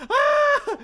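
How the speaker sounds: very high, loud mock scream of pain; a character shot through the hand, hammed-up shriek; pained yelp. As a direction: scream like an arrow hit your hand. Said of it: "it was like".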